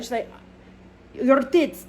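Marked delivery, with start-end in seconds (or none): 1.1-1.9 higher-pitched failed Scottish attempt; Scottish accent. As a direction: have a poor go at Scottish. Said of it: "Nope, see, can't do it".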